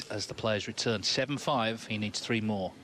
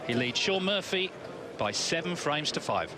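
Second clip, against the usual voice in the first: sports announcer; 6 semitones higher than usual. Acted. End of session summary.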